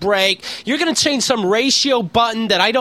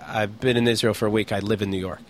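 low, softer deadpan answer; straight-faced; 10 semitones lower, 5 dB quieter than usual. Retold or imitated. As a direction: answer drily, giving nothing away. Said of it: "do I have to have a straight face".

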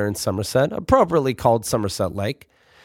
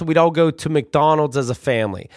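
usual speaking voice

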